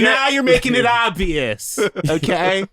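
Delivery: high, loud feigned outrage; mock indignant bystander. Imitating a bystander forced to object to racism, mock indignation. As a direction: protest loudly as a put-upon onlooker.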